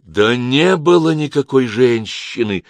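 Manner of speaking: loud, slow, emphatic exclamation; angry outburst. A narrator's reading. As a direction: cry out in exasperated denial. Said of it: "выкрикнул я".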